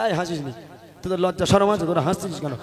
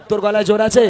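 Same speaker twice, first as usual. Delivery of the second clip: louder, challenging question; 3 semitones higher, 8 dB louder than usual. Real, not acted. Host testing child's voice.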